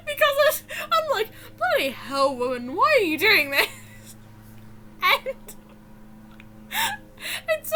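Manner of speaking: words squeezed out through real laughter; talking through helpless giggles; laughing while talking. Real, not acted. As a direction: crack up as you speak, near crying with laughter. Laughing my ass off.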